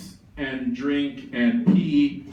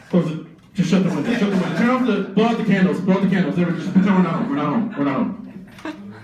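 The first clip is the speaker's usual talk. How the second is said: panicked orders; 3 semitones higher, 4 dB louder, faster than usual. Acted. Jefferson ordering the household to hide, panicked orders. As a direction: hurry everyone to hide the house.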